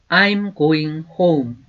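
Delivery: slow, clear teacher's model, each word apart; slow demo pronunciation; slow model sentence. Shown as a demonstration. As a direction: say it slowly for students to repeat. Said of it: "다시 한번 해볼까요".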